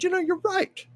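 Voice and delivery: an airy high-voiced Lucy conceding the point. Lucy agreeing with husband Desi, in a high put-on voice.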